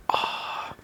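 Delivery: soft voiceless ah of wonder; awed breath; breathy sigh of awe. Real, not acted. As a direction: let out a hushed ah. Awe at the unboxing.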